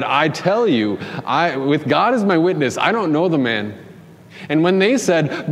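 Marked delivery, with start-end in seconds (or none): 0.0-3.7 higher, emphatic retold oath; insistent denial. Peter swearing with God as witness, acted insistent denial. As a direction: swear it firmly, acting out the denial. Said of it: "who three times had said".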